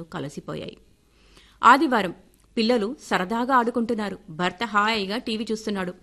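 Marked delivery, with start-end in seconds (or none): none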